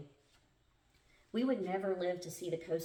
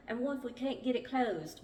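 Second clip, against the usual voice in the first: put-on higher-pitched, amateur English accent; bad English accent; 6 semitones higher than usual. A narrator's reading. Narrator redoing the line as Mark, bad English accent. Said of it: "I know y'all need my bad English accent".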